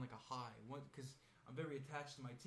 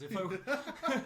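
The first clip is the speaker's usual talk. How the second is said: laughing; 9 semitones higher, 12 dB louder than usual. Real, not acted. Laughing, trailing.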